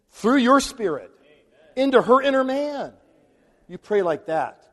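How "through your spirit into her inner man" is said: rising higher and louder into a fervent plea; fervent prayer climax. Shown as a demonstration. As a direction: build the prayer up to a passionate peak. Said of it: "So you gotta pray the Bible".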